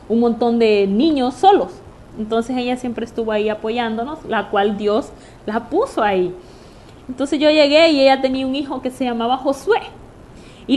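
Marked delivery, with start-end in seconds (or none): none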